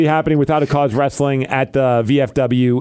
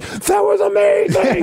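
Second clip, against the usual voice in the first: much higher, slow squeal of delight; thrilled kid; slower than usual. Retold or imitated. Girl telling her brother the fight was amazing, squealing high. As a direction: gush like an excited kid. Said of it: "her brother or whoever".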